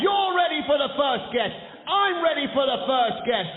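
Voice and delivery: high, loud hype-up; hyped shout. Real, not acted. Host shouting to warm up the studio audience. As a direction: fire up the crowd. Warming up the crowd.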